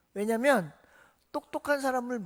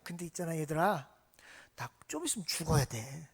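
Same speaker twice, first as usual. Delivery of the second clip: soft confiding; 6 semitones lower, 6 dB quieter than usual. Acted. Jesus foretells his death gently.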